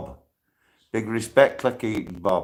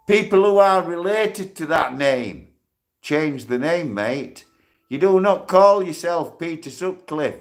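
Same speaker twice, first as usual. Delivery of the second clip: angry telling-off; 4 semitones higher than usual. Real, not acted. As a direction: confront him, voice raised. Angry at the Sutcliffe username.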